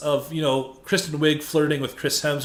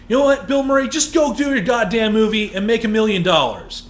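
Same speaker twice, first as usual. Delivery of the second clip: angry rant; 5 semitones higher than usual. Real, not acted. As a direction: really let him have it. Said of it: "So here comes a rant. Get ready".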